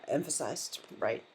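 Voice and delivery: usual speaking voice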